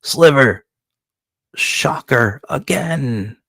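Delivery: a boss barking at his minion; a gleeful villain giving the order, slowed down; villain's command. Acted. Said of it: "Jumpin' Joey's like".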